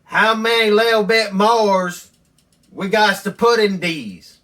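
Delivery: high, loud put-on voice of a simple buddy; imitating Earl. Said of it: "He says".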